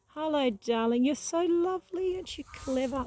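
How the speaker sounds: high, soft tender coo to a little creature; cooing to a bee. Real, not acted. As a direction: gently sweet-talk the bumblebee.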